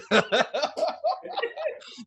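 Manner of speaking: high, long burst of laughter. Real, not acted. Host laughing at his own bad Denzel. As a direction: laugh at yourself.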